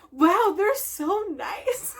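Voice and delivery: high, drawn-out mock gushing; gushing amazement. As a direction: imitate delighted fans, stretching the words slowly. Both sides gushing, acted quote.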